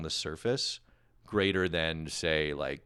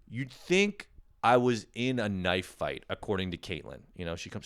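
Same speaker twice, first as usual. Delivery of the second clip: ordinary delivery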